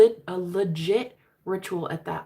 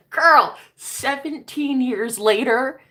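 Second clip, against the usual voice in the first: slow and drawn out, louder; drawn-out emphasis; 7 semitones higher, 9 dB louder, much slower than usual. Real, not acted. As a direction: stretch every word for drama. Building up the big reveal.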